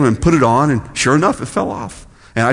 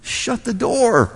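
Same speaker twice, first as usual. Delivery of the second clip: voicing Jesus; 4 semitones higher, 4 dB quieter than usual. Jesus telling followers to pray privately, soft instruction. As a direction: spell it out gently, with pauses. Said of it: "He says".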